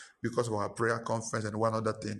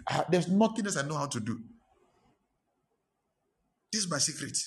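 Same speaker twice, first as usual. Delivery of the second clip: emphatic declaration; 3 semitones higher than usual. Acted. Preacher declaring his secret.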